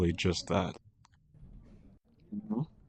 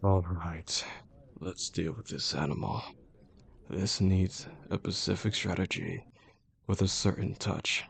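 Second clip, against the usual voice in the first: hushed, lowered voice, plotting; quiet menace; 7 dB quieter than usual. Acted. Boss alone, planning to deal with this animal, quietly menacing.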